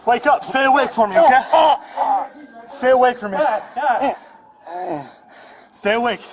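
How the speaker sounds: loud, high urgent calls to stay awake; urgent shouting. Real, not acted. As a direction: shout to keep him conscious. Officer urging dying suspect to stay awake.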